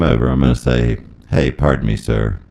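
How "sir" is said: ordinary talk, nothing marked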